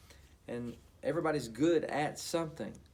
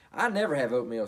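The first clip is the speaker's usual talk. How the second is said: huffy; 7 dB louder than usual. Acted. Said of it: "she huffed".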